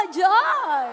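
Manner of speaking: high, bright happy call; joyful exclaim. Shown as a demonstration. Teacher has the class say joy, excited call. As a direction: say it with joy again. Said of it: "let's say it with emotion".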